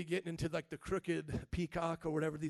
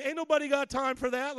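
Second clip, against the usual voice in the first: loud, higher-pitched brush-off; God's mock dismissal; 6 semitones higher, 9 dB louder than usual. Preacher acts God answering empty prayers, loud dismissal. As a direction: wave it away loudly, acting God. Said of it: "'Cause God is, like, saying".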